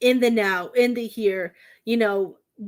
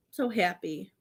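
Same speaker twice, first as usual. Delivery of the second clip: normal talking voice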